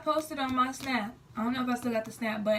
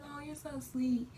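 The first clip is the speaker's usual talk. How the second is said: sweet cooing; 4 semitones higher, 5 dB quieter, slower than usual. Retold or imitated. Retelling her cooing reply.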